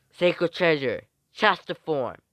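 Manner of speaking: loud, high summoning call; acted call-out. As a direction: proclaim the weapon's name. Calling out a sacred treasure's name.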